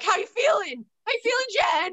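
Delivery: high, teasing "how you feeling"; mocking voice. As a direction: sing it up high like a taunt. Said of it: "we're like".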